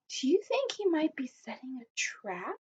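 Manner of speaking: put-on a secret whisper to the children. Teacher whispers a suspicion to her listening children. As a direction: lean in and whisper the question.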